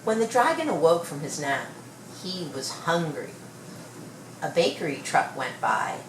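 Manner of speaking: ordinary delivery, nothing marked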